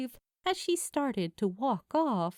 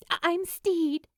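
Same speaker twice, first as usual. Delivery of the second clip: blurted; 8 semitones higher, 4 dB louder than usual. A narrator's reading. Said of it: "she just blurted out".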